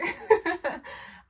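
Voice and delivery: teary laugh. Real, not acted. Laughing while tearing up.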